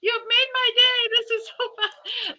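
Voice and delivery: very high, gushing and delighted; overjoyed. Retold or imitated. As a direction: squeal it with pure delight. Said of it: "And I was like".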